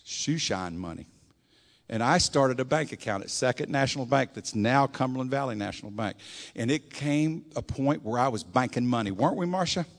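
ordinary talk, nothing marked

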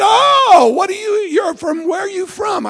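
a hint of a nosy old stranger; lightly voiced old man. Old man on bus starts talking.

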